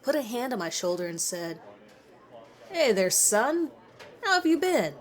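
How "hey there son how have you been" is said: a tipsy teacher greeting a student he barely knows; drunk teacher. Acted. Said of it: "I could smell some strong whiskey on his breath".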